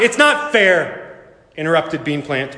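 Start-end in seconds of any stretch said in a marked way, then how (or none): 0.0-1.3 high, long-drawn complaint; wailed protest. A narrator's reading. Bean Plant interrupting Lily's message, wailed protest. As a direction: cut in with a slow, high protest.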